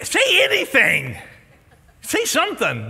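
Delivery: high, loud prodding; urging host. Retold or imitated. Host urging the stumped contestant, high and loud. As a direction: egg the contestant on.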